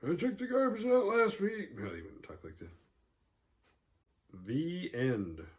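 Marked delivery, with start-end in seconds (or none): 0.1-1.7 put-on much higher, grumbling old-timer voice; bickering old person. Immortal couple bickering centuries later, old-person grumble. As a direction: nag like a cranky old spouse. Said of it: "bickering like old people".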